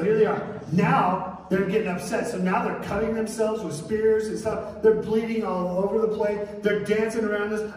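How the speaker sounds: fired-up sermon storytelling; preaching at full force. Acted. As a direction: preach it big to the room.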